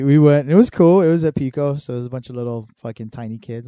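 ordinary talk, nothing marked

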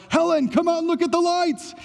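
high, excited Clark Griswold shout. Retold or imitated. Pastor imitates Clark calling his wife outside, excited. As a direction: call out to Helen, thrilled by the lights. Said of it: "like the Clark Griswold moment of".

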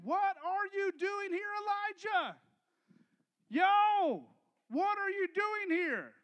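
God calling out to Elijah; a street-casual divine call. Acted. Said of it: "And a voice said".

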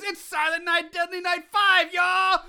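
big booming showman intro, high and loud; hype host voice. Acted. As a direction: shout it like a wild holiday emcee. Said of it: "I haven't done that in a while".

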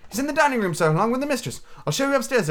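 high-pitched, polite maid; servant girl. Acted. Servant girl showing Scrooge in, high polite voice. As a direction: pitch it far up as an obliging girl.

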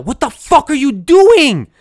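screamed high and loud, enraged; furious yell. Retold or imitated. Guard screaming at a lost new inmate, loud enraged yell. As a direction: yell it as the angry guard would. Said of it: "And not even like that".

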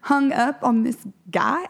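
put-on high, incredulous teen voice; teen daughter. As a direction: tease mom like an amused teenager. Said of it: "Lucy's like".

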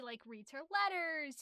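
normal talking voice

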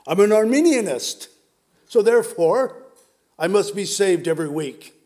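loud acted declaration; mock proclamation. Preacher mimics an Arminianist needing saving weekly, loud mock proclamation. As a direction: proclaim it like a doctrinaire believer.

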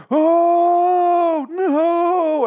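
long, high, hammed-up wail; mock horror. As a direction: howl it in exaggerated dismay. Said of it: "That's the look on his face".

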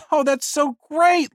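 Liz voiced high. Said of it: "Liz responded".

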